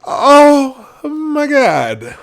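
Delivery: real laughter spilling into a very slow "oh my god"; laughing. Real, not acted. Laughing at Biden's answer, slow and loud. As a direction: crack up and drag the words out. Cracking up at the clip.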